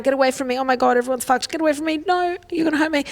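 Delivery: mock panic, backing away from everyone; fearful shutting down. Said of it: "One is fear, shutting down".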